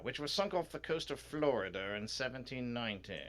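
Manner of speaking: proud British curator voice, lifted a little; British museum official. Acted. Museum official shows off the pirate gold, proud British voice. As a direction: present it as a grand English curator.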